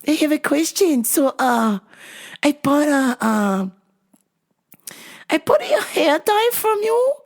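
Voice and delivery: high, broken-English imitation of the old mom. Old mom complaining to the clerk her hair dye never works, high mimicked voice. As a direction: mimic the mom asking for help. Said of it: "she said".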